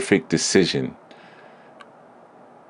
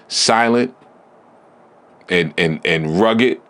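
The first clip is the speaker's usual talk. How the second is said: ordinary delivery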